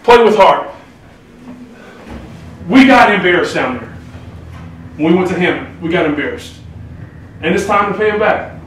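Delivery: loud pep talk yell; rally the team, shouting; fired-up shout. Real, not acted. Coach pep talk before game.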